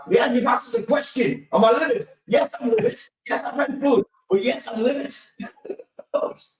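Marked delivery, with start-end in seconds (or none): 0.1-5.1 higher, building to a shout on "Yes, I'm livid"; half-comic furious rant; livid outburst. Retold or imitated. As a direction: let the retold fury spill over, pitch climbing. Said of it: "Of course I'm fucking livid".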